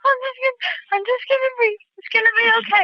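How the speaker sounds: high, crying, fighting for composure; tearful self-soothing. Real, not acted. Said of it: "I shouldn't be crying".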